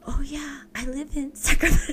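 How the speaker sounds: high, dopey self-mockery tipping into laughter; mock moron. Mocking own reply to the woman abroad, moronic. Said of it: "responded back like a fucking moron".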